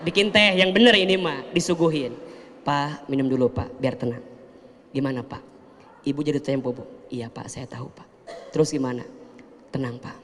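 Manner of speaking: quietly reassuring wife; calm and gentle. Retold or imitated. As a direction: soothe the debt collector calmly. Calm wife hosting debt collector.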